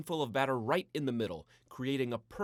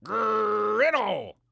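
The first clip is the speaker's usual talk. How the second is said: much higher, loud yappy bark; dog bark; 9 semitones higher, 12 dB louder than usual. A narrator's reading. The other dog answering the first, in a high loud bark. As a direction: yap back like a small dog answering. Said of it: "barked in response".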